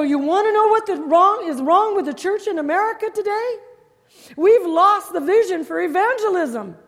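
loud, high, driving preacher's voice; fiery preaching. Acted. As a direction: preach it hard to the pastors. Preaching at full force.

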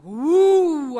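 very loud drawn-out whoop; awestruck "woo". Retold or imitated. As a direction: whoop it out, struck by a revelation. Reacts to hearing God.

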